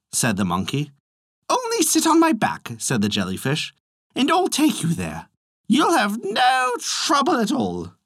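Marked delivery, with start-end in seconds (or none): 1.5-2.7 much higher eager coaxing voice; jellyfish voice. A narrator's reading. Jellyfish luring the monkey to Dragonland, high and coaxing. 4.1-7.9 much higher eager coaxing voice; jellyfish voice. A narrator's reading. Jellyfish luring the monkey to Dragonland, high and coaxing.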